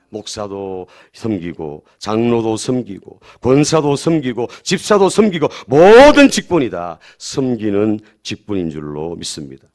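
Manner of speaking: building preaching. Real, not acted. Pastor tells every church officer to serve, building preaching. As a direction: build the list step by step to a ringing climax.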